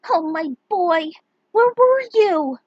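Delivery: high, slow teary cry of relief; weeping with relief at his return; tearful mother. Acted. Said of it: "burst into tears".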